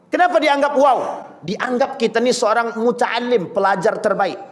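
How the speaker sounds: ordinary delivery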